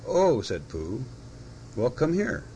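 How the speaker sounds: startled high oh; surprised and high. A narrator's reading. Pooh startled at the door.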